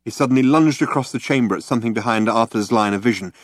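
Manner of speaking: plain reading voice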